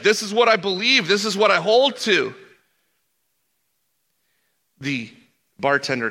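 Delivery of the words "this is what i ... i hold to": high, loud mock of a matter-of-fact pastor; raised, mocking plainness. The man voicing the pastors' plain belief, high and amused. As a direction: lift it high like a bemused impression. Inner quote at higher pitch.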